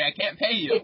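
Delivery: usual speaking voice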